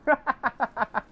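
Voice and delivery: high burst of giggles; helpless laughter. Real, not acted. Laughing about talking rats.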